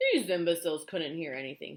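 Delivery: loud, scornful outburst; cried out. A narrator's reading. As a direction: crow it loudly, mocking them. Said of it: "he cried".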